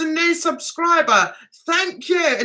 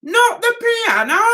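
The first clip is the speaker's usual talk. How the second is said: high, half-laughing "Not the piano"; laughing quote; 8 semitones higher than usual. Retold or imitated. Retelling her tweet, laughing. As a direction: repeat it, giggling. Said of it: "I put that down as a quote".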